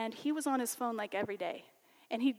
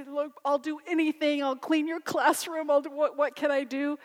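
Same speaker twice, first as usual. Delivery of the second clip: pleading student; 4 semitones higher, 6 dB louder than usual. Retold or imitated. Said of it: "as much as he tried to say".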